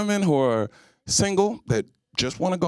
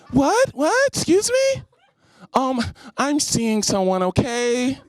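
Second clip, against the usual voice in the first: high rising outraged "what?", much higher; indignant taken woman; 7 semitones higher, slower than usual. Retold or imitated. Impression of a taken woman at the club, high indignant outrage. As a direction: bristle as if someone touched you. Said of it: "my impression of women who are in relationships".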